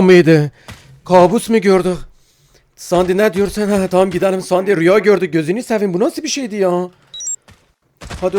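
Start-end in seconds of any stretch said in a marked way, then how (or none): none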